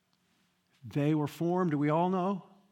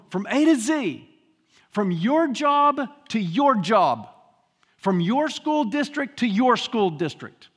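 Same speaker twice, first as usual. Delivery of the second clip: louder, ringing repetition; preaching at full force; 2 semitones higher, 7 dB louder than usual. Acted. Preacher exhorting the congregation, loud. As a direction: build it up and declare it.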